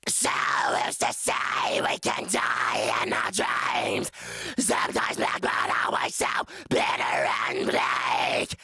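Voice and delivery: low guttural distorted growl; deep rasping growl. Shown as a demonstration. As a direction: rumble it out like an earthquake. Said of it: "Here we go".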